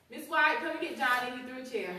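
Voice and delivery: mock urgent call. Teacher calling the director over a thrown chair, mock urgent. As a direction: summon the director in a hurry.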